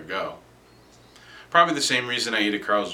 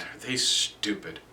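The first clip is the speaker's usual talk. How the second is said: ordinary delivery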